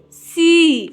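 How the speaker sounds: सी with the ee held long. Shown as a demonstration. Teacher sounding out S double E, stretched सी. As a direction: draw out the vowel of सी. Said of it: "थोड़ा लंबा होगा".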